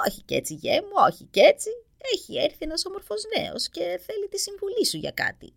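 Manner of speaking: high, playful hag voice; playful old witch. A narrator's reading. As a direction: tease the son like an old witch. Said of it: "του είπε παιχνιδιάρικα". (παιχνιδιάρικη φωνή γριάς)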